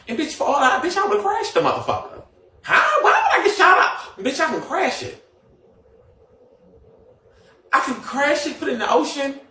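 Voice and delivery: mocking the insurance-scam rumour, laughing; high incredulous rant. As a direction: squeal the denial like it's absurd.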